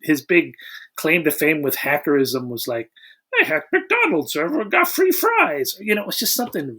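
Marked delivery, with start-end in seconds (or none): 3.4-5.8 high mock bragging voice; smug hacker boast. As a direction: brag it pitched way up like a proud kid.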